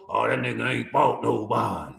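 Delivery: mocking critics. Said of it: "If you said that shit".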